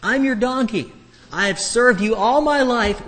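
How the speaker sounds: high, loud, pleading talking donkey; loud high-pitched donkey protest. Acted. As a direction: cry out as the beaten donkey begging its master.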